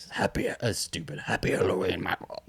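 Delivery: low, whispery movie-villain delivery; mock the cheesy line in a hushed voice; mocking movie one-liner. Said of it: "I didn't like her saying".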